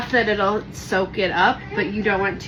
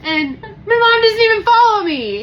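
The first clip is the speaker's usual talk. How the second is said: mock heartbreak; 7 dB louder than usual. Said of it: "I'm gonna cry right now".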